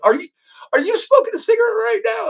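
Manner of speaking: very high, quick mock outrage; shocked mom catching her kid, hammed-up; high-pitched mock telling-off; imitated scolding mom.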